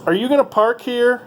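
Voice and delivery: high, rising mock voice of the pushy woman; mimicking the parking lady. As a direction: squeak the question up like the nosy attendant. Repeats the woman's question.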